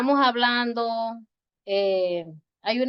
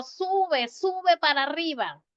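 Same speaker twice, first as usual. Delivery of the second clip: "Sube" called out high; calling to a child on the hill, much higher; 6 semitones higher than usual. Pretend. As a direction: call the child up the slope. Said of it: "yo digo".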